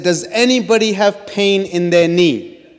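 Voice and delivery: nervous call to a crowded train carriage. Retold or imitated. Calling out to packed train.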